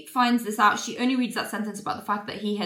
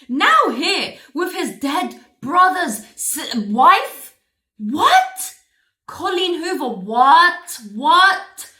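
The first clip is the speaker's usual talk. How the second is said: loud, high, dragged-out disbelief; flabbergasted outburst, rising questions; incredulous; 7 semitones higher, 9 dB louder, much slower than usual. Real, not acted. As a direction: let each "what" swoop up louder. Incredulous at the author's plot.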